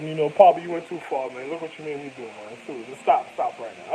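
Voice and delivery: low, hushed, dead serious; quiet threatening plea; low warning. Retold or imitated. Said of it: "I was like".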